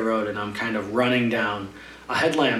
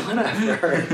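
laughing dismissal; 8 semitones higher than usual. Retold or imitated. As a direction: shrug it off while laughing. Laughingly brushes off his complaint.

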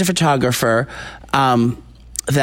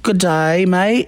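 pitched-up imitation of an Australian accent; mock Australian accent; 6 semitones higher, much slower than usual. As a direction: ham up a fake Australian greeting. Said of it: "Was she really talking with the accent?".

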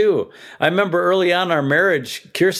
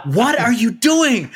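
high, startled question; quoting a startled wife; alarmed wife; 8 semitones higher than usual. Retold or imitated. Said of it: "Beth is like".

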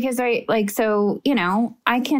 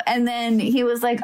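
normal talking voice

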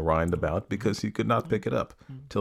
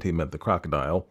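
ordinary delivery

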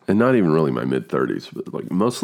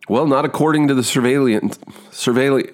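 fixated stumble; 9 semitones higher than usual. Retold or imitated. Actor fixating on one word mid-scene, stumbling. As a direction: get stuck repeating "surveillance". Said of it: "I get fucking focused on the word".

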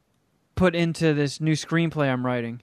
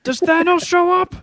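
much higher, louder goofy question; mock excited question; 13 semitones higher, 6 dB louder than usual. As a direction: play a kid asking eagerly. Joking question.

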